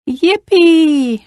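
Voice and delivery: put-on high-pitched little girl cheer; excited shout. A narrator's reading. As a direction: cheer with childlike glee, pitch lifted. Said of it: "shouted the little girl".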